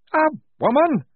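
high, loud shocked outburst; astonished shout. Acted. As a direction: blurt it out in disbelief. Major astonished.

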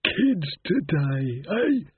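slow, high, odd skull voice; a dry old skull grumbling; talking skull. A narrator's reading. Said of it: "Then the skull said".

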